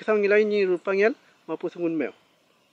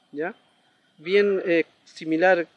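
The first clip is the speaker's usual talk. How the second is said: usual speaking voice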